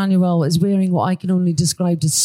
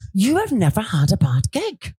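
normal talking voice

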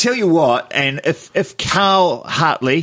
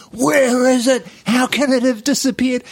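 high, frantic disbelief at the missing email; frantic searching; 11 semitones higher than usual. Retold or imitated. Retelling his email hunt to a friend, frantic higher pitch. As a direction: panicky higher voice, pleading with the inbox. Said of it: "I'm going absolutely freaking nuts".

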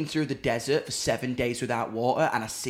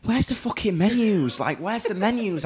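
high, frantic demand for menus; hammed-up panic about the menus; mock hysterical; 7 semitones higher than usual. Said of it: "just turning hysterical".

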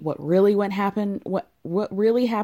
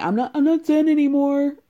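higher, louder mock freak-out voice; bad Sheena impersonation; 6 semitones higher, 6 dB louder than usual. Host mimicking the freaking-out bride in the closet, bad impersonation. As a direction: badly imitate a woman losing her cool. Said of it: "I can't do a Sheena accent or impersonation".

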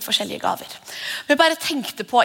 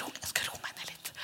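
a timid, fading whisper; self-quieting whisper; 19 dB quieter than usual. Acted. A believer tells herself to calm down, timid whisper. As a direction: whisper it, shrinking into yourself.

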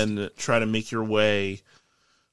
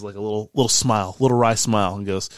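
ordinary talk

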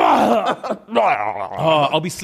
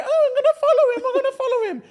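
much higher, laughing imitation of a clingy partner; mocking the wife. Husband mimicking his wife stalking him, high and laughing. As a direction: ham up her whiny voice. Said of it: "and you were like".

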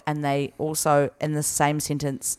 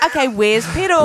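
town crier; 6 semitones higher, 5 dB louder than usual. Pretend. Playful chant call.

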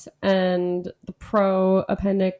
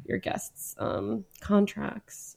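sly whisper; 7 dB quieter, slower than usual. Real, not acted. Sly suggestion of trick clause.

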